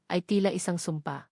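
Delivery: ordinary delivery, nothing marked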